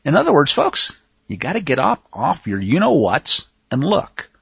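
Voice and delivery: usual speaking voice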